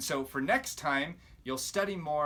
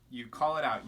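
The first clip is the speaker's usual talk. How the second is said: usual speaking voice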